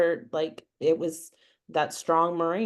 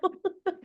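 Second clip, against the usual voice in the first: real laugh; 14 semitones higher than usual. Real, not acted. Laughing at retold moment.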